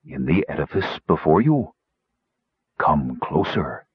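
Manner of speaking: deep voice, slowing to a summons; low, mysterious beckoning; soothing bass. A narrator's reading. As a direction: invite them in with a low, soothing tone. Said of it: "said a soothing bass voice".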